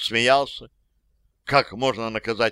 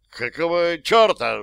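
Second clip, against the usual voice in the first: angry outburst; 6 semitones higher, 4 dB louder than usual. Acted. Mafioso snaps at captor.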